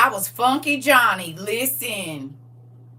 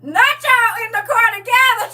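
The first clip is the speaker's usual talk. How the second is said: usual speaking voice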